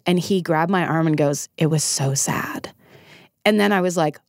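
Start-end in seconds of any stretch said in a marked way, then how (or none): 1.6-2.7 slow, breathy hushed whisper. Retold or imitated. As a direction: lean in and whisper it gravely. He grabbed my arm, whispered.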